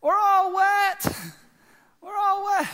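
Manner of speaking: high, drawn-out mock wail; imitated distant cry for help. Girls under a leaking tarp calling for help, mock crying out. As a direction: call out loud and high, hammed-up distress. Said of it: "I heard a cry out".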